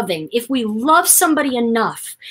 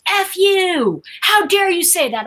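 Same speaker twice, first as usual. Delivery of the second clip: higher, angry comeback; angry retort; 9 semitones higher than usual. Retold or imitated. Friend retorting at speaker, angry. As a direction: snap back at her, offended. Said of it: "he goes".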